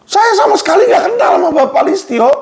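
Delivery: high, fast shout of protest; frustrated shouting. Real, not acted. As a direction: yell it out, pitch up and racing. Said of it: "saya teriakkan".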